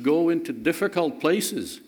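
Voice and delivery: ordinary talk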